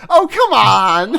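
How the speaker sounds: loud, high, drawn-out protest; mock outrage. Real, not acted. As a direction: cry out in playful indignation. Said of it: "giving it my all here".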